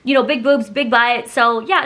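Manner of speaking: ordinary talk, nothing marked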